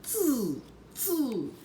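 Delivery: high, soft cheeky monkey chatter; monkeys mocking. A narrator's reading. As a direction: mimic him back, quietly teasing. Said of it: "shook both of their hands back at him and said".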